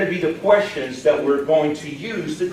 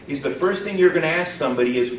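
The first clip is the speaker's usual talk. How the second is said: ordinary talk, nothing marked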